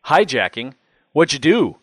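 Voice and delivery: amazed. A narrator's reading. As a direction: echo it back wide-eyed and impressed. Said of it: "eyes widening".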